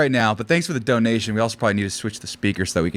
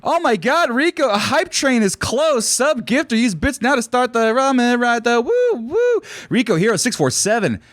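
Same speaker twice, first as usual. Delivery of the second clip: excited; 11 semitones higher than usual. Real, not acted. Hype train announcement on stream.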